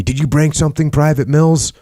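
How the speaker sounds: mild narrated yell. A narrator's reading. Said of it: "yelled back at me".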